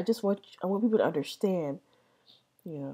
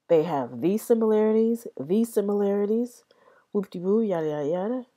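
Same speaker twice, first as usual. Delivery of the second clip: raised, louder mock-scholarly recital; mocking linguists; 5 semitones higher, 5 dB louder than usual. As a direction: lightly parody an academic ticking off findings. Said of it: "what they like to do is say".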